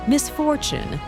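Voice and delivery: ordinary talk, nothing marked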